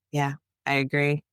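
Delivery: normal talking voice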